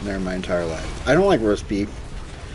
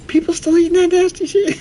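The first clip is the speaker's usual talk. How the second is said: high, louder squeaky mock voice; high disgusted mock voice; mocking Chisel; 4 dB louder than usual. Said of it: "He was like".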